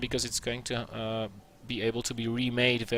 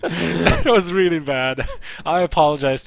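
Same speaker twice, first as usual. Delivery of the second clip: laughing; 3 semitones higher, 7 dB louder than usual. Real, not acted. Caster admitting his Irish accent was awful, laughing. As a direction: laugh while you own up to a bad attempt.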